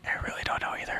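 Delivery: fast whisper. Real, not acted. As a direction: breathe it out, no voice. Whispered answer.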